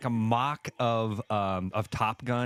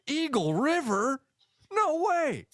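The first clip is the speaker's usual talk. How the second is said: very high, hammed-up disbelief; amazed movie quote; 14 semitones higher, slower than usual. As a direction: mimic an incredulous movie crowd, pitched way up. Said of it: "all I hear in my head is".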